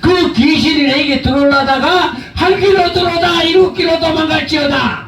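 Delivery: rising to a shouted command; preaching command. Acted. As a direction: cast the demon out at full force.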